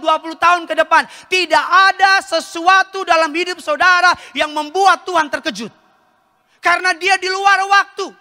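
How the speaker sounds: high, emphatic preacher's voice; preaching. Acted. Preaches at raised pitch. (khotbah berapi-api dengan nada tinggi)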